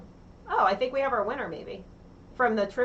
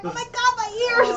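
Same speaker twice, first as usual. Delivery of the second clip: high-pitched mock protest over hurt ears; mock pained outcry; 13 semitones higher, 4 dB louder than usual. Host voices the viewers after the yell, mock pained outcry. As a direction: cry out as if deafened, playing it up. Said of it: "and now they're going".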